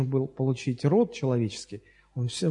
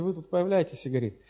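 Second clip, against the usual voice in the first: ordinary talk, nothing marked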